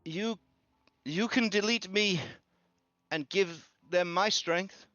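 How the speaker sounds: high, small earnest voice; high tearful girl's plea; pleading child. Acted. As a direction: offer yourself bravely as a child. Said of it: "Corinna says".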